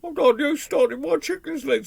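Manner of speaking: high, put-on whining grumble; aggrieved high-pitched robber; complaining robber. A narrator's reading. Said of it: "robbers started complaining".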